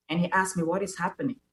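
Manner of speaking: ordinary delivery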